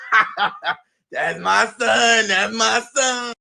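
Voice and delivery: slow, laughing through the words. Retold or imitated. Mother laughing proudly at her son's comeback, slow laughter. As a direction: chuckle proudly while saying it. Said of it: "She laughing like the shit is funny".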